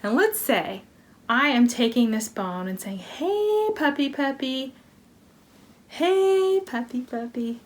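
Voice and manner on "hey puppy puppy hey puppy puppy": high, sing-song call to a puppy. Pretend. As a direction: coax the dog over with a bright high call. Coaxing a dog with a bone.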